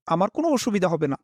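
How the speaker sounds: ordinary delivery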